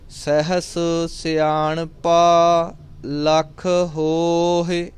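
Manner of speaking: very slow, sustained chant with long vowels; scripture recitation. Shown as a demonstration. Teacher reciting Japji for students, slow measured chant. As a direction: draw out each line on a level, held tone.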